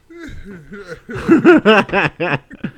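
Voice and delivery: high hearty laugh; big laugh. Real, not acted. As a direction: burst out laughing. Laughs at own punchline.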